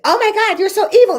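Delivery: high, loud mock-shocked gasp; hammed-up horrified churchgoer.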